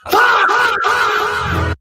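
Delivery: loud, high, long cackle; screaming laugh. Real, not acted. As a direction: burst out in shrieking laughter. Cracking up after the patois.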